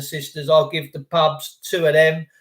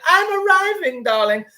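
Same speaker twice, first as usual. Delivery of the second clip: far higher, exaggerated posh swoop; 11 semitones higher than usual. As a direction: push the snob voice over the top. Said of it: "That's what posh people say".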